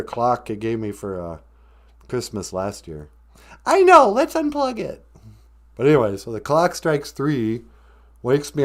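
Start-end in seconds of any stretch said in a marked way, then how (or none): 3.7-5.0 high squeaky wife interjection. Acted. Wife suggesting they unplug the clock, squeaky.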